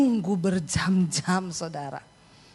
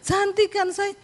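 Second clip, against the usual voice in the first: coy fishing; 9 semitones higher than usual. Retold or imitated. Wife fishing for a compliment.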